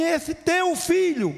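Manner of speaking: high, slow, scornful 'Esse teu filho' with 'filho' dragged out; bitter contempt. Acted. Angry older son disowning his brother to the father, scornful. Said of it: "aquele cara tava tão revoltado que ele falou assim".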